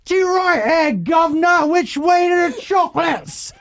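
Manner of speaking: loud, high-pitched Cockney shout; Cockney accent. Shown as a demonstration. Host warming up for nervous kids, exaggerated Cockney accent. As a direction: belt out a hammed-up Cockney. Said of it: "I think that's a Cockney accent".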